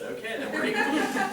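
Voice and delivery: high, laughing punchline; laughing comeback. Real, not acted. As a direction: laugh through the tease. Joking comeback to Ted.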